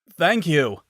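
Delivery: loud, high grateful call; calling out thanks. Acted. Scientist calling thanks as Samus walks away. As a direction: call it after her joyfully. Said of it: "called to her".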